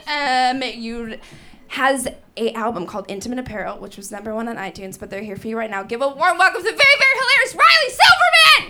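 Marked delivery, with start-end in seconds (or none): 5.8-8.7 fast, building to a high shout; announcer building to a loud high shout on the name; host announcement. Real, not acted. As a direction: hype the next comic up. Host introducing comic.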